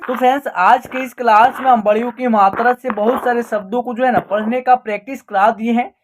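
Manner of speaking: usual speaking voice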